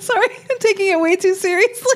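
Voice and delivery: high-pitched, laughing through the words; laughing apology. Real, not acted. Co-host apologizing for taking it too seriously, laughing. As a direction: apologize while cracking up.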